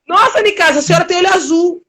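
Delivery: amazed neighbor. Retold or imitated.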